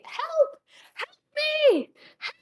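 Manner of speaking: high, loud shriek for rescue; shrieking cry for help. Acted. Game master voices a stranger calling for help, shrieking. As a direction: shriek it like someone trapped. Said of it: "hear this loud shrieking".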